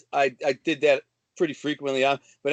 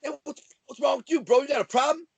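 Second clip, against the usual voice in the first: higher, fast mock challenge; challenging tough guy; 9 semitones higher, faster than usual. Imitating a club tough guy picking a fight, mock challenge. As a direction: throw it out like a cocky bar guy. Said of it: "But if they're like".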